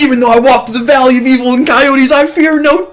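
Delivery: high, loud panicked psalm; frantic prayer. Retold or imitated. Terrified hiker reciting a psalm while running, high. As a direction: pray fast to stay brave.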